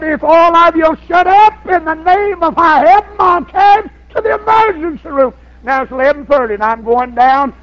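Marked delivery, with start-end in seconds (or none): 0.0-5.3 raised, higher fed-up yell; exasperated shout. Retold or imitated. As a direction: yell over the crying family. Grandpa yelling at crying family.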